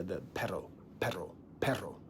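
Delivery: perro said with a trilled R; Spanish perro. Shown as a demonstration. Showing viewers the Spanish trill, rolled R. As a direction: roll the R of Perro. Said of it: "That R, the word for dog".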